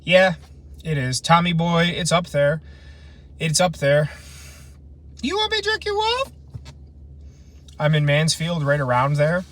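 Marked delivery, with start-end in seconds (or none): 5.2-6.3 very high, squeaky joke voice; silly falsetto. Pretend. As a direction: leap way up into a cartoonish falsetto. Joke line in a put-on voice.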